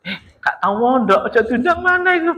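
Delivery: high-pitched incredulous gossip, breaking into laughter; mock disbelief. Jamaah reacting to an unschooled preacher, high laughing disbelief. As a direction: scoff in a squeaky mock-listener voice.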